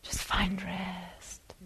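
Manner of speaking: fading to a slow whisper; soft whispered rest. Retold or imitated. Tibetan teacher soothing an exhausted mind, whispered slowly. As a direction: breathe the words out, barely voiced. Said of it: "in this beautiful accent".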